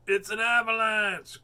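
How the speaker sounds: slow, loud alarmed cry of a toad; frightened cry. A narrator's reading. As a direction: cry out that the avalanche is coming. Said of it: "cried Toad".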